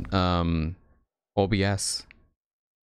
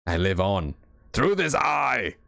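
slow, shouted hero proclamation; dramatic Snake line; slower than usual. Acted. Streamer playing Snake proclaiming his legacy, slow shout. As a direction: proclaim it slowly like a legend.

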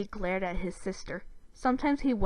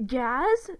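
higher, louder questioning cry; startled cry; 4 semitones higher, 6 dB louder than usual. A narrator's reading. Boys realizing the creature is the sister, crying out. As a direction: blurt the name in disbelief. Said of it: "Zim and Dib both cried out".

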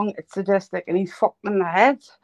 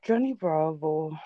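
a hint of a lower, slowed cartoon hunk voice; Johnny Bravo voice; 5 semitones lower, 5 dB quieter, slower than usual. Streamer trying out the cartoon voice for his friend, lightly done. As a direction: lightly put on the cartoon lunk's swagger. Asked to do the voice.

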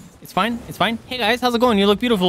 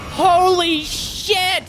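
drawn-out shocked yell; 10 semitones higher, much slower than usual. Real, not acted. Overwhelmed by attack in game.

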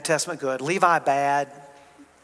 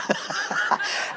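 breathy laugh; 14 semitones higher, 4 dB quieter than usual. Real, not acted. Laughing at his own joke.

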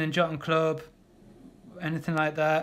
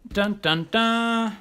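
fanfare; 4 semitones higher, much slower than usual. Real, not acted. Reveals the finished painting.

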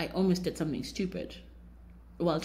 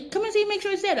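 much higher, casual call, lightly voiced; maintenance guy; 6 dB louder, much faster than usual. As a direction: play the repairman inviting her to look. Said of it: "He's like".

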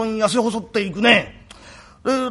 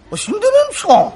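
high, fast, half-laughing surprise; startled surprise; 12 semitones higher, 4 dB louder, much faster than usual. Acted. Kuma, caught out by the father, blurts in high surprise. Kuma misunderstands son is dead.

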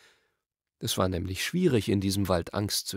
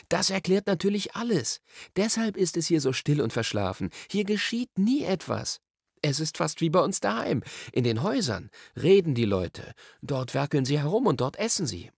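put-on higher boy-character voice, animated; Diggory, carried away; 7 semitones higher than usual. A narrator's reading. Diggory carries on without hearing Polly, eager. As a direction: run on eagerly with the idea.

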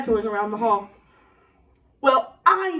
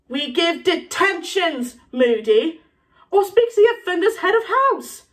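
exasperated professor, high and loud; slow reprimand climbing higher; McGonagall; 6 semitones higher, 4 dB louder, slower than usual. Acted. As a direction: lay down the rules, indignant. McGonagall scolds Moody.

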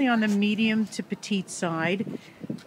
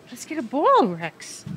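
excited; 4 semitones higher, 5 dB louder than usual. Real, not acted. Playing ball with the dog.

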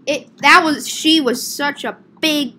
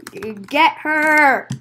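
drawn-out call. Acted. Calling for the customer's capture, held back. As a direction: call it out without full volume.

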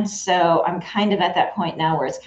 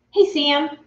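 ordinary talk

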